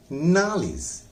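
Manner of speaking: Knowledge with the first part नाल stressed; stress on nal. Shown as a demonstration. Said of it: "फिर नाल पर जोर लगाएंगे". (नॉलेज में नाल पर जोर)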